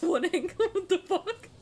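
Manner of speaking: shocked laugh. Real, not acted. Shocked by child at window.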